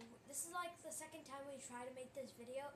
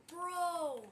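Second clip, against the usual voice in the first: loud shout; 5 semitones higher, 10 dB louder than usual. Real, not acted. Friends freaking out at game.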